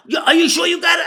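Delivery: high, loud, fast shout; disbelieving shout. Retold or imitated. Joey shouting at the teller in the street, disbelieving. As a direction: yell it, can't believe it.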